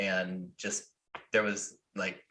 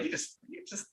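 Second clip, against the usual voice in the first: muttered brush-off; 10 semitones higher, 13 dB quieter than usual. Retold or imitated. Retold dismissive brush-off.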